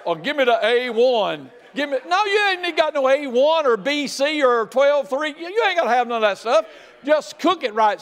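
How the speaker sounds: high, loud, fervent steak sermon; loud, laughing testimony about grilling; excited preaching. Real, not acted.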